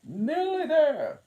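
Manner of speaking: high, loud, slow queenly voice; the Queen repeating it loftily. A narrator's reading. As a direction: draw it out high and grand. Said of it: "the queen repeated".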